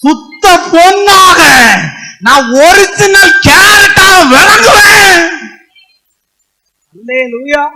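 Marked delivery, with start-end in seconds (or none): 0.0-5.2 slow, high, crying shout of Job's vow. Acted. Preacher quoting Job to the church, slow crying shout. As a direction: proclaim Job's words like a triumphant cry. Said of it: "அதனாலதான் யோபு சொன்னாரு".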